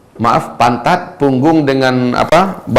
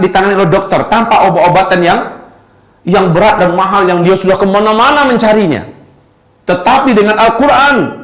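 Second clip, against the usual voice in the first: raised, high-pitched preacher's voice; impassioned preaching; 7 semitones higher, 4 dB louder than usual. Real, not acted. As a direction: proclaim the miracle to the congregation, pitched up. Berkhotbah dengan semangat. (berkhotbah berapi-api dengan nada tinggi)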